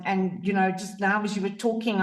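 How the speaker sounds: ordinary delivery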